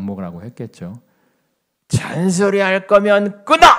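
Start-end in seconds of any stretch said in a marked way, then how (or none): 1.9-3.7 loud, high, drawn-out shout; angry yell. Retold or imitated. Old patient hangs up on his nagging daughter, furious yell. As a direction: imitate a patient exploding at being nagged.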